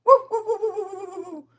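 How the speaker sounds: loud, high run of woos; whooping. Real, not acted. Calling out in the middle of the story, high whooping. Whooping amid the retold call.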